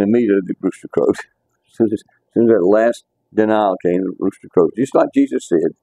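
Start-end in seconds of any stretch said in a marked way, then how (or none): none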